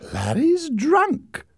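high, slow, scandalised report; tattling sister. A narrator's reading. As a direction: deliver the gossip with relish. Sister reporting drunk brother.

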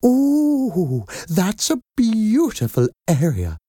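very high, admiring coo; Madam Doubtfire. A narrator's reading. Nanny admiring the mother's address, gushing. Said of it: "said Madam Doubtfire".

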